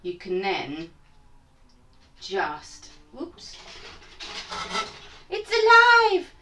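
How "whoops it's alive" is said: mock horror. Chicken slips, mock horror.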